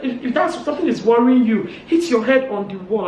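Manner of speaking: high, loud outraged insults; shouting. Retold or imitated. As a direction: re-enact a shouted tirade, easing slightly. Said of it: "she started shouting".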